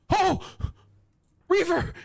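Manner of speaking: sudden high panicked cry; alarmed shout. Real, not acted. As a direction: yelp his name in alarm. Character suddenly in danger.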